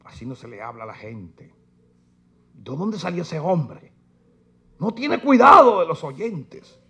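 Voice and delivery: prissy mock-scandalized seminary voice; mocking seminarians. Preacher mocking seminary students, scandalized tone. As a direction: sniff at the rude preacher. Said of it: "Sabe lo que dicen los que están en los seminarios".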